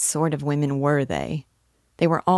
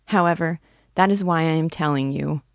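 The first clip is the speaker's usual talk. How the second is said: ordinary delivery, nothing marked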